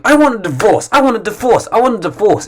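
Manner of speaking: the exasperated wife nagging on and on; demanding divorce. Retold or imitated. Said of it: "kept saying".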